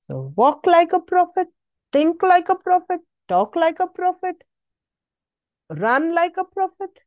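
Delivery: high, ringing call to the congregation; preacher rallying the church; rousing exhortation. Acted. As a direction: lift them up with each line. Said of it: "rising preacher exhortation".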